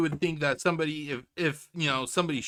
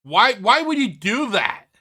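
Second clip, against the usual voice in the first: exasperated demand; 4 semitones higher, 9 dB louder than usual. Real, not acted. Repeated demand to Phil.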